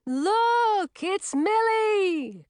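high, drawn-out delighted-witch holler; booming high-pitched character shout; loud shout. A narrator's reading. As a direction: call it out big and high, stretching the words. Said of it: "shouted in her loud and distinctive voice".

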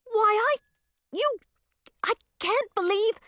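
very high, halting sputter; flustered high-pitched stammering. A narrator's reading. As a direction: start and break off, too indignant to finish. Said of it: "obvious that Zoe was flustered".

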